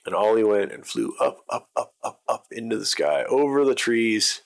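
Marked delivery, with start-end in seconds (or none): none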